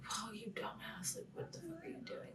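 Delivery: high, hushed exasperated scold; exasperated whisper. Retold or imitated. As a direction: scold him under your breath. Yelling at Paul, retold.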